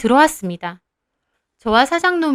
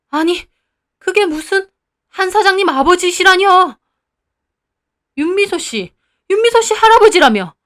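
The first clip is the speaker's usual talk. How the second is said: much higher, louder, panicky; flustered disbelief; 7 semitones higher, 6 dB louder than usual. Acted. Owner confused about the grandfather, rising high and panicky. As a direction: stammer in disbelief.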